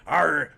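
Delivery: loud pirate growl Arr. Pretend. Pirate voices being discussed.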